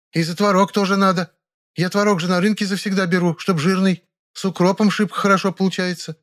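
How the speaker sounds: high-pitched, rambling village-woman complaint; indignant jabber. A narrator's reading. Nyura rambles about money, butcher and curd, indignant. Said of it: "возмущённо опять затараторила она".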